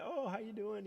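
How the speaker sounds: high, friendly greeting; friendly preacher. Retold or imitated. Imitating the preacher greeting him after, friendly. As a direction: greet them like the nicest guy. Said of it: "the nicest guy".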